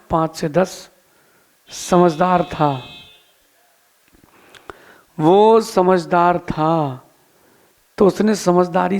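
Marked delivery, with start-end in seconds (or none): 1.7-7.0 very slow, deliberate repetition for emphasis. Real, not acted. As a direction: draw out समझदार था, word by word. Driving home the story's key point. (धीरे-धीरे, ज़ोर देकर)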